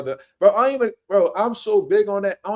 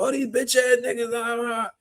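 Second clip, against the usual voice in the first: mock taunt; 6 semitones higher than usual. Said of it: "he talking about".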